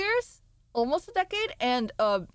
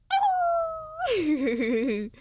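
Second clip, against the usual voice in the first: shrieking laugh. Real, not acted. Streamer shrieks with laughter at chat. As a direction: burst into a shrill laugh.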